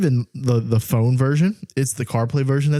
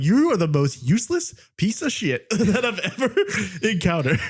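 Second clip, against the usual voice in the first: higher, slow insult breaking into laughter; frustrated rant; 6 semitones higher than usual. Retold or imitated. Telling off Siri in the car, laughing frustration. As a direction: rant in mock outrage, laughing through it. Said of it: "I get so frustrated by it".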